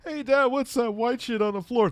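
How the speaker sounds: very high mock kid voice; son as bad actor. Son asking dad about the floor, high hammy voice. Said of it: "And his son's like".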